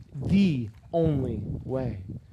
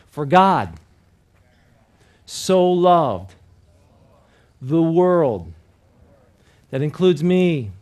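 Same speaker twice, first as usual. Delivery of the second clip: louder, spaced phrases for the crowd to echo; loud call for repetition; 6 dB louder, much slower than usual. Shown as a demonstration. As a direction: call it out line by line for them to repeat. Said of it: "listen and repeat after me".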